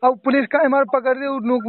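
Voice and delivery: usual speaking voice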